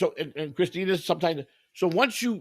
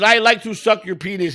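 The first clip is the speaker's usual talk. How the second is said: mock Indian accent; 5 semitones higher, 7 dB louder than usual. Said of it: "You went to a wannabe Indian voice".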